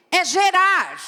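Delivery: "É gerar" called out loud and high; emphatic declaration. Real, not acted. Preacher exclaims "É gerar" to the congregation, loud. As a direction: proclaim "É gerar" with force. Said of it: "Ah, você não entendeu".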